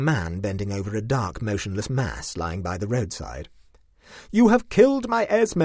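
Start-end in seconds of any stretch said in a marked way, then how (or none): 4.3-5.6 loud, high, bitter accusation; grief-stricken outburst, raised and sharp; bitter exclamation. A narrator's reading. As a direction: hurl the accusation at him bitterly. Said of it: "I exclaimed bitterly".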